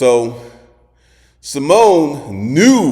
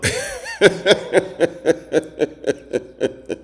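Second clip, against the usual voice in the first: long run of voiceless chuckling; long laugh; 7 semitones higher than usual. Real, not acted. Remembering what Simone knew, long chuckling laugh. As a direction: chuckle on and on in short bursts.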